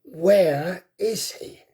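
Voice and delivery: slow, voiced, menacing dragon hiss; gathering strength, hunting his prey; stronger hiss. A narrator's reading. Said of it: "hissed a little more strongly".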